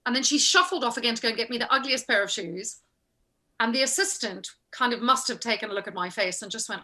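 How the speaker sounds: normal talking voice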